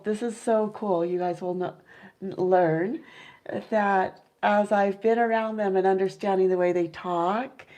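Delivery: usual speaking voice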